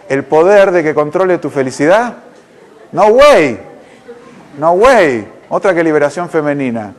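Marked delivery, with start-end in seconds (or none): 2.9-5.3 exclamación alta y tajante; loud, emphatic refusal; rechazo indignado. Acted. Indignado ante ceder el control. (rechazo indignado y enérgico)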